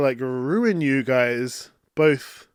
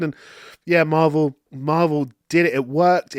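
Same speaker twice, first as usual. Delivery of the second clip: usual speaking voice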